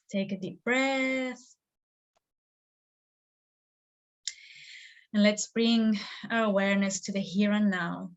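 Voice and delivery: slow, drawn-out guidance with long pauses; slow meditation guide, letting phrase endings linger; guided meditation. Acted. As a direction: lead them gently into stillness, stretching the words.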